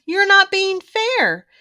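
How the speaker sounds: whiny protest. A narrator's reading. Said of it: "spouted the Cubs".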